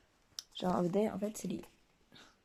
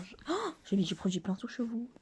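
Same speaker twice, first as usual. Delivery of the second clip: ordinary delivery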